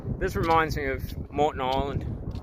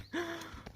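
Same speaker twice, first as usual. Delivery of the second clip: sharp high frightened gasp; startled gasp; 12 dB quieter than usual. Real, not acted. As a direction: gasp as a bug hits you. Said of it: "Oh, that was so scary".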